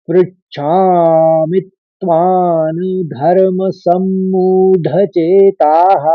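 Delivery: "पृच्छामि त्वान्" joined with a light त, slow; careful recitation. Shown as a demonstration. Teacher demonstrates the second line, slow and measured. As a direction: stretch "चेताः" with a falling visarga. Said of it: "पंक्ति को इस तरह से उच्चारित करेंगे".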